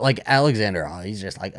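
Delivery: ordinary talk, nothing marked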